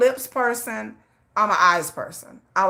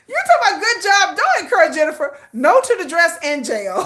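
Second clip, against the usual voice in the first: high, louder, laughing through the words; real laughing higher and louder; laughing reaction; 8 semitones higher, 5 dB louder than usual. Real, not acted. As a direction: read the comment while cracking up.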